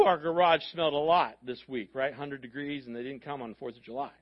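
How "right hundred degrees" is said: ordinary talk, nothing marked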